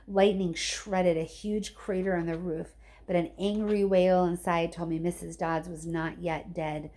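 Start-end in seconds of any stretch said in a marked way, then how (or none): none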